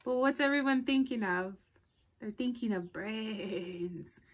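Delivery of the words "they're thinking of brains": spooky hush. Acted. Spooky aside to child.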